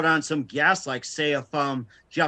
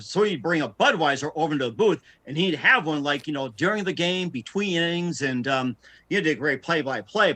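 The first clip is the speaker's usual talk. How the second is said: ordinary delivery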